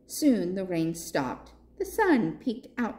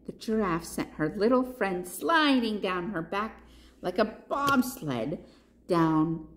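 ordinary delivery, nothing marked

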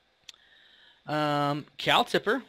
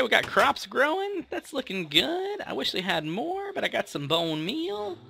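much higher, put-on silly voice; odd high voice; 10 semitones higher than usual. Minecraft player checking crops in a silly high voice. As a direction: chirp the crop report in a funny high voice. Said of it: "Don't know why I'm talking like that".

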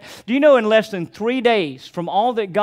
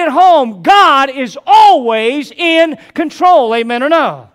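preaching at full force; 4 semitones higher, 6 dB louder than usual. Acted. Pastor to congregation about trusting God, rising to full-force preaching. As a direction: build to a fervent, louder climax, slowing on the key words.

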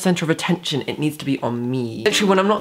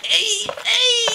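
rising squeals, very high; excited squeal; much slower than usual. Real, not acted. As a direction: squeal higher each time. Overjoyed at new trainers.

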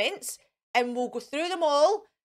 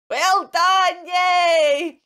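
high, loud shout of praise; excited teacher cheer; cheering yay; 4 semitones higher, 7 dB louder, much slower than usual. Real, not acted. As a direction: celebrate them loudly. Cheering children on.

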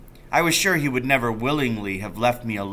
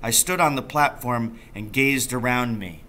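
usual speaking voice

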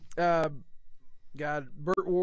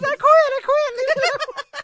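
much higher, louder, slow blubbering through laughs; hammed-up crying quit; mock sobbing Robin; 9 dB louder, much slower than usual. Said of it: "He goes".

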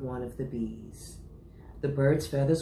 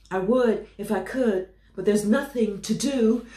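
building to a sneeze; 8 semitones higher, 9 dB louder than usual. Acted. Elephant about to sneeze.

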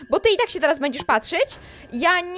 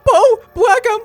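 loud, higher crying plea; mock sobbing plea; 6 semitones higher, 7 dB louder than usual. Begging Paul to stop, in hammed-up sobbing. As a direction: beg through fake tears.

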